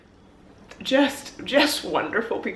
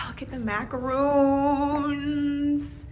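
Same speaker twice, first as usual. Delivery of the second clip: slow, higher, stretched-out cooing; gushing admiration; 5 semitones higher, 4 dB quieter, much slower than usual. Real, not acted. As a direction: draw out the last word admiringly. Gushing over treats in room.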